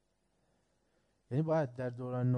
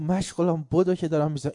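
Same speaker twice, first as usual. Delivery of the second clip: retold shout; 5 semitones higher, 4 dB louder than usual. Man calls neighbour, on fire.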